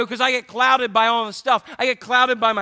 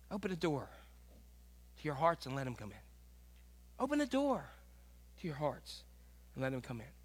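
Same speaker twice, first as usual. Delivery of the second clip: soft plea; 6 semitones lower, 17 dB quieter than usual. Acted. Soft pleading invitation.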